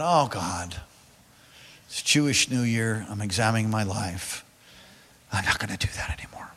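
soft hush of a private resolve; whispered inner vow. Retold or imitated. Pastor retelling his vow to God, whispered. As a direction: whisper it as if praying to yourself.

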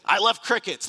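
put-on loud, high burst of toddler enthusiasm; mock toddler outburst, high and loud; excited toddler. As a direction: blurt it out like a random little kid. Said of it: "You ever talk to a toddler".

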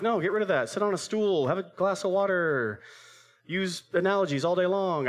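high-pitched imitation of preachy friends; high, insistent mock coaching; mocked friends' advice.

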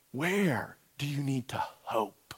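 slow, hushed whispered question. Real, not acted. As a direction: ask it under your breath. Hushed prompt before quiet prayer.

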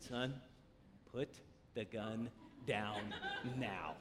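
very low, hushed, slow order; measured words with long pauses, dead serious; tense, controlled command. Retold or imitated. Father to armed five-year-old son, tense quiet command.

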